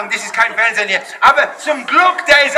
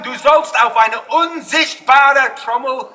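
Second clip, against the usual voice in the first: ordinary delivery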